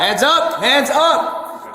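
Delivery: shouted command. Real, not acted. Officer commanding suspect to surrender.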